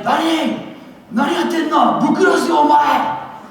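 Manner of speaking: high, loud furious yell in Japanese; screamed in Japanese. Retold or imitated. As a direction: scream his name like an enraged bully. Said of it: "he screamed my name".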